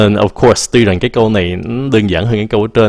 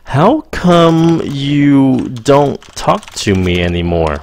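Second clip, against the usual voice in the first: slow model sentence; 5 semitones higher, much slower than usual. Shown as a demonstration. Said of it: "Người bản xứ dùng cái này rất là nhiều".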